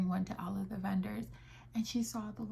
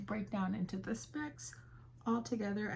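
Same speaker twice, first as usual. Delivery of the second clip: ordinary delivery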